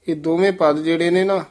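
ordinary talk

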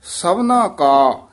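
ਸਭਨਾ ਕਾ drawn out slowly, then a firm stop. Shown as a demonstration. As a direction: lean on ਸਭਨਾ and hold back at ਕਾ. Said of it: "ਇੱਥੇ ਠਮਕ ਲਾ ਦੇਣੀ ਹੈ". (ਕਾ ਤੇ ਠਮਕ)

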